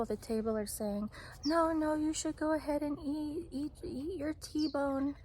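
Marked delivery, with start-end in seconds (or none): 1.5-5.1 higher-pitched, placating urging; imitating coaxing diners. Other diners coaxing the husband.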